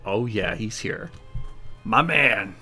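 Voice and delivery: ordinary talk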